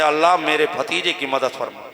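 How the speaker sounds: hushed, low-pitched supplication; low prayer. Acted. Uncle prays to God for his nephew, low prayer. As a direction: pray it gently and low. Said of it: "दुआ करते हैं".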